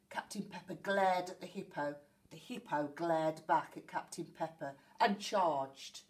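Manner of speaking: plain reading voice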